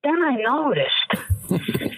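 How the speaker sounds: dad hamming up his suspicion; mock dad. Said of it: "He goes".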